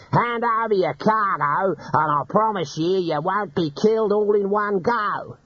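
high, slow mocking threat; grinning bandit, high and slow. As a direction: sweet-talk a threat at him. Bandit's mock-friendly threat.

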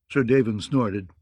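ordinary delivery, nothing marked

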